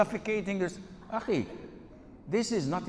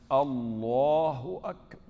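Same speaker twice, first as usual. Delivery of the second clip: slow, stretched-out Allahu Akbar, louder; drawn-out takbir; 5 dB louder, slower than usual. Retold or imitated. Mimicking the worried man's endless takbir, slow and drawn out. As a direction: drag out every syllable like he doubts it.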